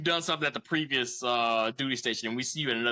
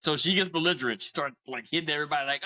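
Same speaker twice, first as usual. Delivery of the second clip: normal talking voice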